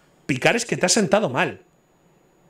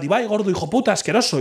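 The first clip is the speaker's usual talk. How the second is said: higher, jeering insult read out; mock chat insult; 7 semitones higher than usual. Imitating a chat troll insulting him, jeering and higher.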